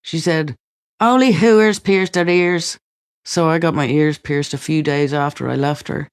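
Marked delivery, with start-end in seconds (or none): 1.0-2.8 mother's sneer, higher, louder and slow; imitating a scornful mother's warning. Mother's scornful remark, retold.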